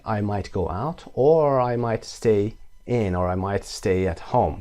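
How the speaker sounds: slow, deliberate example; slow model answer. Shown as a demonstration. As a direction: say it slowly for the class. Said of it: "what are you going to do". (آهسته برای تکرار)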